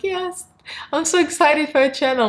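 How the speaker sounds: gushing fans. Retold or imitated. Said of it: "they're like".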